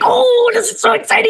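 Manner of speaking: put-on high, bright-eyed kid's squeal; excited little boy. Little boy at school, thrilled to start drawing, high excited voice. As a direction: gush like a kid thrilled on his first day. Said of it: "And he's like".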